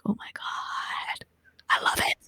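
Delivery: breathless slow whisper of delight. Retold or imitated. Watching the spoons bend, whispering in wonder. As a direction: hush it out like you're in awe. Reacting in awe to bending.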